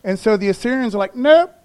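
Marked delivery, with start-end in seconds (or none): 1.1-1.5 a sudden loud high nope; loud flat refusal. Retold or imitated. Assyrian soldier refusing the Judeans, loud and mocking. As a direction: bark the refusal like a taunting soldier.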